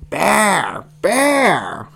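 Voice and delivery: high, wild creature yell; wild one-word outburst; yelling little monster. A narrator's reading. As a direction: yell it like an excitable baby beast. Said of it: "yelled Animal".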